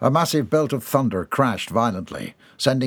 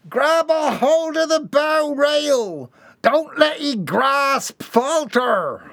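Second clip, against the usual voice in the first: high, slow called orders; crewman's command; 3 dB louder, much slower than usual. A narrator's reading. Cowlick ordering Mortimer to the rail, high slow call.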